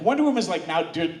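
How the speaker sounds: usual speaking voice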